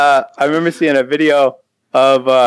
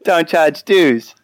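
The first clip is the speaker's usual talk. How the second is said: slow, higher mock Australian accent; 4 semitones higher, much slower than usual. Shown as a demonstration. Cohost reading a listener's comment on request, Australian accent. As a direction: stretch it out in a put-on Australian accent. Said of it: "All right, Australian accent".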